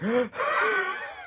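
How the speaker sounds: high, thin imitated gasp; a hammed-up gasp of relief; mock gasp. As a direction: gasp as if shocked. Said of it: "you're just like".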